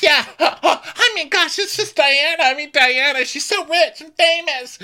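very high, loud mock fawning; hammed-up sycophantic giggling; gushing laughter. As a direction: laugh and gush in a squealing falsetto. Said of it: "laughing all over Diana".

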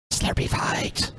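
slow breathy whisper. Shown as a demonstration. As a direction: whisper it slowly. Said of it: "repeats it whispered".

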